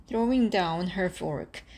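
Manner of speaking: plain reading voice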